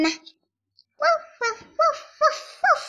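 high yappy little woofs; high dog barks. Acted. Paul the dog barking back at Arthur, high yappy woofs. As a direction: bark like an eager puppy.

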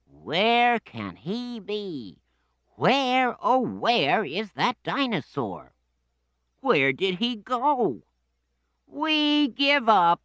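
high, hammed-up mock searching; children pretending. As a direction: overact looking for him like kids. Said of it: "said the children".

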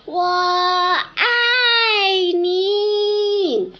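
high, loud tender call. Shown as a demonstration. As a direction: show how to call it to the mountain. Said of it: "你回去对着大山喊".

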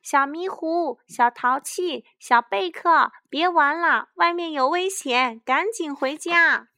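put-on high, loud calls to children outside; ringing shout of warning; calling out. A narrator's reading. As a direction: holler the little ones home, urgently. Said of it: "帮着呼喊".

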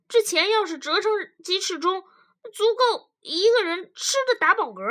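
a husband grumbling, pitch climbing as he goes; complaining husband. Acted.

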